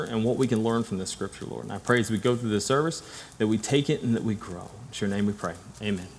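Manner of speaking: soft, earnest prayer voice. Real, not acted. Pastor leading the congregation in prayer, soft and earnest. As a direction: pray it quietly and humbly. Opening prayer before the sermon.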